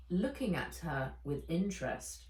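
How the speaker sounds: ordinary delivery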